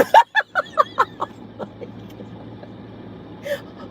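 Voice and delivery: high wheezing laugh, almost a cry; helpless squeal of laughter; can't stop laughing; breathless squealing laugh. Real, not acted. Cracking up at comment.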